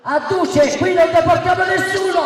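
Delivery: high, shouted rally oration; booming balcony speech. Real, not acted. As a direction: thunder it from a balcony. Heated rally speech, shouting at opponent.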